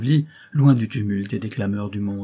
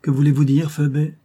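raised, haughty challenge; loud, higher-pitched question; proud cry; 4 semitones higher, 6 dB louder than usual. A narrator's reading. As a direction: demand an answer as the proud mistress. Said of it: "cria Milady fièrement".

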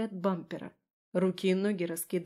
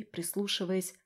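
ordinary delivery, nothing marked

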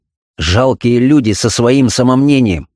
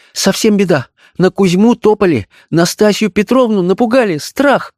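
high-pitched frightened maid's report; alarmed maid; 11 semitones higher than usual. A narrator's reading. Maid reports trouble to her mistress, high and alarmed. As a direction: tell the bad news in a high, alarmed voice. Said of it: "Аннушка шептала ей".

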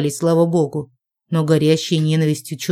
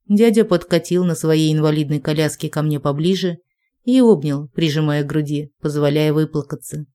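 ordinary delivery

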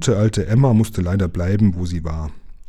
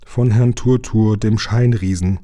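usual speaking voice

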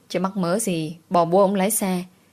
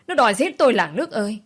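higher, louder, faster wail building to a peak; 4 semitones higher, 4 dB louder, faster than usual. Acted. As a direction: scream it out to the whole village. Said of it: "vừa gào". (gào to hơn, dồn dập)

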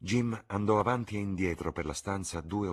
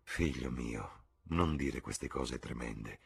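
soft, slow murmur; 4 semitones lower, 6 dB quieter, slower than usual. A narrator's reading. Mother gently scolding her son, soft murmur. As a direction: plead softly with your son. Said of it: "mormorò la signora Vane".

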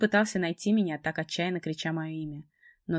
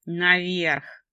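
harsh male command; 6 dB louder than usual. Acted. Trevor orders her upstairs.

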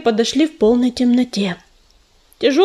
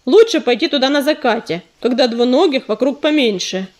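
ordinary delivery, nothing marked

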